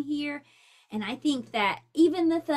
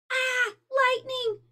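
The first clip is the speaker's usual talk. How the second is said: much higher, slow scared cry; frightened shout; 12 semitones higher, 4 dB louder, slower than usual. Acted. Franklin terrified by the lightning strike, frightened shout. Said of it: "shouted Franklin".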